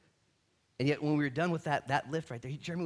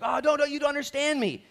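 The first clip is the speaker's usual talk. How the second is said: higher, louder whine; whiny protest; 12 semitones higher, 8 dB louder than usual. Retold or imitated. Congregant wronged by others, pleading in a higher whine. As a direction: whine like the falsely accused. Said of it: "You're just like".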